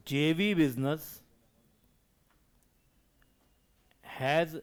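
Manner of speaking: slow dictation. Acted. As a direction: dictate word by word, with long pauses. Dictating notes to the class.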